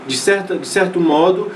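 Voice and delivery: ordinary talk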